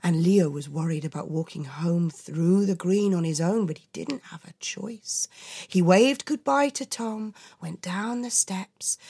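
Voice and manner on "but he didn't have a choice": whispering. A narrator's reading. Whispered narration.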